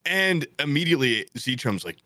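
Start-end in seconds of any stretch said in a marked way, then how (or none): none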